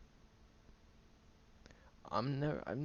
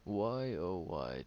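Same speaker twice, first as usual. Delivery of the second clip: theatrical, louder sigh of why; mock dramatic lament; 3 semitones higher, 5 dB louder, much slower than usual. Teasing the friend with a hammed-up why. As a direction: play up the self-pity, tongue in cheek.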